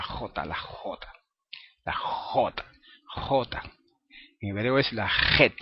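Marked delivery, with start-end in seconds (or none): 0.0-3.6 "J, la J" with a hard raspy velar jota; Spanish strong J. Shown as a demonstration. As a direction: push air hard through the back of the throat on "J". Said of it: "como pronuncian muchos españoles la J".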